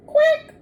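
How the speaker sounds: high quack; duck quack. Acted. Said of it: "says the mother duck".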